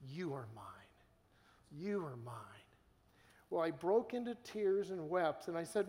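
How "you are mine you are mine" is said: softer, lower tender assurance. Retold or imitated. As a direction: hush it like holding someone close. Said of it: "he held me and he said to me twice".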